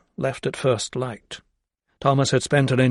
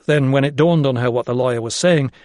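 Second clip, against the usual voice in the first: ordinary delivery, nothing marked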